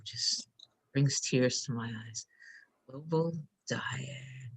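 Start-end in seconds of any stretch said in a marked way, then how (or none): 2.9-4.5 slow, soft whisper; awed whisper. Real, not acted. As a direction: whisper it slowly, moved. Moved, says it in awe.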